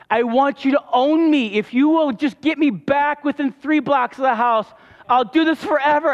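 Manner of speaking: high, loud pleading prayer cried out; desperate cry to God. Retold or imitated. Running man cries out to God, loud pleading. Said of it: "And I'm crying".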